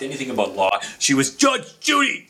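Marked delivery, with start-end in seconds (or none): 1.3-2.3 sudden high, loud TV-judge exclamation; Judge Judy catchphrase. Retold or imitated. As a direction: burst out like the TV judge announcing herself. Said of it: "She just transformed".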